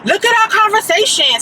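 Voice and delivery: high, insistent mock demand; high-voiced imitation of an insistent man; imitating the caller. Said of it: "the dude was like".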